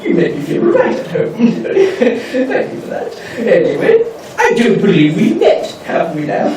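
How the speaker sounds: pompous aristocrat, swooping pitch, drawn-out key words; Benjamin Wickerdent. Acted. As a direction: play a haughty, grandiose lord who relishes ceremony. Plays pompous gentleman from eighteen hundreds.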